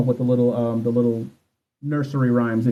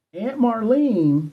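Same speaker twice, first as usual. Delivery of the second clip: slow, higher mock country drawl on the name; imitating country accent; 8 semitones higher, much slower than usual. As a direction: stretch out "Aunt Marlene" in a put-on country accent. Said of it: "has a thick ass country accent".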